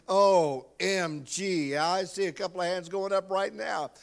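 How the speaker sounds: ordinary delivery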